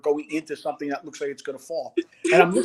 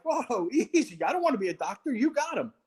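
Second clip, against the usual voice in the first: higher-pitched, placating retort; mock backing off; 6 semitones higher than usual. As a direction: retell hastily backing off. Backs off from screaming medic.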